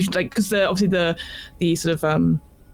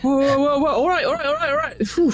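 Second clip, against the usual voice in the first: high, tumbling placating words; acted high-pitched alarm; panicked surrender; 7 semitones higher than usual. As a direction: play a pinned man begging for calm. GM plays tackled man surrendering.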